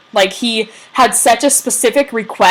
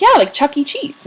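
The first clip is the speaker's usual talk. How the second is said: teasing; 6 semitones higher than usual. Retold or imitated. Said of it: "No, I'm just kidding".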